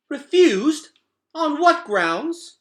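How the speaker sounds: high, loud, outraged demand; a queen affronted by the refusal; indignant. Acted. Luxa hearing the crawlers refused, loud high indignant demand. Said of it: "head snapped up indignantly".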